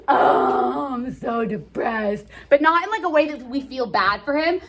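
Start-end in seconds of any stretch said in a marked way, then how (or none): 0.1-2.2 slow, teary, exaggerated moping; hammed-up lovesick sighing; teacher mocking Romeo's self-pity, slow and teary; mock depressed. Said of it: "Romeo's like".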